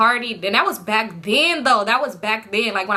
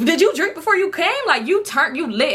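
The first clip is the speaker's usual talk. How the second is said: higher, incredulous mock questioning; mock amazement; 6 semitones higher than usual. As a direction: imitate an amazed friend, pitched up. Acting out amazed friends' reaction.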